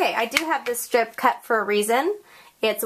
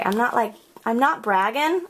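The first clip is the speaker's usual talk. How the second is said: normal talking voice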